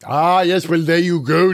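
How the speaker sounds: ordinary delivery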